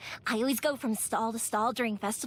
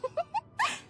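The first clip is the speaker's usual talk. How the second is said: giggle. Real, not acted. Delighted after hero pose.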